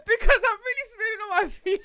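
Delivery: high, loud tearful giggle; laugh-crying at her own oversharing. Real, not acted. Laughing embarrassed confession.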